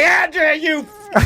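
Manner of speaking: high-pitched mock outburst at wife; mock angry blame. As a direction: curse her in a hammed-up high voice. Acting out blaming wife after fall.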